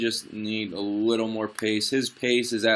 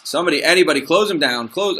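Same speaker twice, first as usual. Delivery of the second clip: raised, higher pleading yell at his defenders; urgent shout; 6 semitones higher, 6 dB louder than usual. Real, not acted. Player yelling at his defenders on screen, urgent shout. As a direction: beg someone to close him down.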